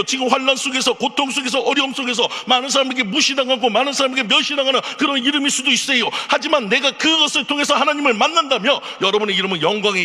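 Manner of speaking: high, loud pulpit proclamation; fervent preaching. Acted. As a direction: build the sermon to a ringing climax. Applying sermon to listeners.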